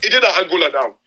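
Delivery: high, pleading outcry with a break in it; impassioned rant. Real, not acted. Host pleading to listeners about Africa's resources, impassioned. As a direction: rail against the waste, almost crying out.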